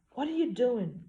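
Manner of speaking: alarmed question. Acted. Alarmed heroine as car parks.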